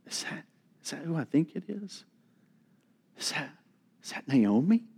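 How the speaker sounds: hushed, fast, disbelieving murmur; gossiping under your breath in disbelief; murmured whisper. Acted. Townswomen murmuring to each other, hushed and fast.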